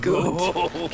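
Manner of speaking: laughing approval. Acted. She answers his flattery.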